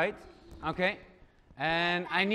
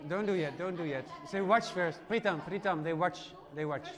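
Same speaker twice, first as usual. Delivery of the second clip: hushed; 4 semitones lower, 9 dB quieter than usual. Real, not acted. Teacher hushing class.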